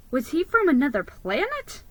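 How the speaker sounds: ordinary delivery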